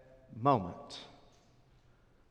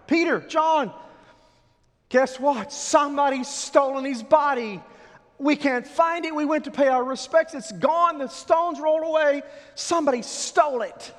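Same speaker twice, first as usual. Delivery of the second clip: much higher and louder, tumbling out in alarm; frantic report; 9 dB louder than usual. Acted. Mary Magdalene telling Peter and John the body is gone, frantic. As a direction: burst in with terrible news.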